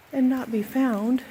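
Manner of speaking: ordinary talk, nothing marked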